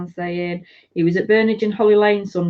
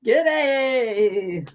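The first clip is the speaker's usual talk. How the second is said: long, falling mock-Australian greeting; bad Aussie accent; 3 semitones higher than usual. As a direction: attempt an Australian g'day, badly. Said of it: "I can't do an Aussie accent".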